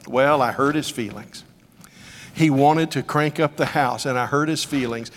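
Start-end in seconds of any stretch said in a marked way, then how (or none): none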